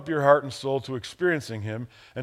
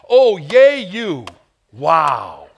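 mocking cheer, much louder; sarcastic stadium whoop; 12 dB louder, much slower than usual. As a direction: pastor mocking cheering over a football. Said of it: "Sometimes you have to talk like that to guys".

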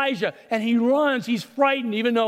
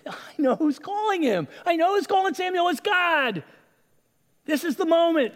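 much higher, fast, dawning awe; excited realization; 8 semitones higher than usual. Acted. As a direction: let it break over him that it's God. Eli realizes God calls Samuel.

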